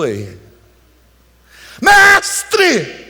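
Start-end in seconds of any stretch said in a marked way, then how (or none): 1.8-3.0 grito agudo e esticado de socorro; apelo apavorado. Acted. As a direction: chame o mestre em pânico teatral. Encena discípulo em pânico no barco. (grito de desespero encenado)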